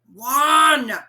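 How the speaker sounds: put-on the old man silencing the boy; sternly. A narrator's reading. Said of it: "the carpenter said sternly".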